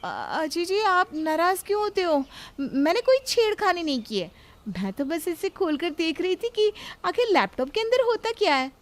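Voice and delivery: high, stammering, defensive wife; nervous innocence. Acted. Pinki stammering excuses to her husband, high and nervous. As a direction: plead innocence while fumbling words.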